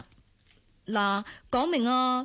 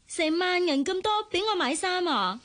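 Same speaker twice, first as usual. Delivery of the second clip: higher, delighted disbelief; amazed; 6 semitones higher than usual. Real, not acted. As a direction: gasp at the cash with glee. Father hands her big money.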